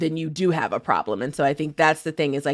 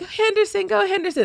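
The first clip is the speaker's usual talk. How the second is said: cheering. Retold or imitated. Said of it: "you were just like".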